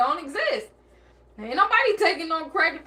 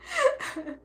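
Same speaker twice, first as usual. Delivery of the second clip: high laugh; 9 semitones higher, 6 dB quieter than usual. Real, not acted. Host cracks up.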